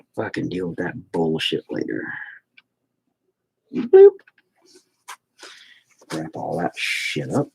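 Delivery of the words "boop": high, loud playful boop. Real, not acted. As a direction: pop it out like a cartoon. Playful sound while painting.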